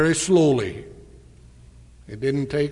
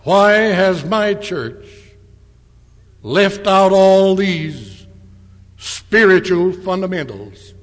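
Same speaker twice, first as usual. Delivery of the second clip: loud, raised, slow and emphatic; exasperated loud voice; 4 semitones higher, 7 dB louder than usual. Retold or imitated. Man questioning his own church in exasperated loud voice. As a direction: retell his exasperated outburst, drawing out each word. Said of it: "as if he were exasperated, he said in a loud voice".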